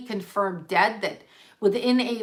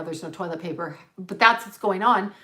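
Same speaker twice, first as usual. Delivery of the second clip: ordinary talk, nothing marked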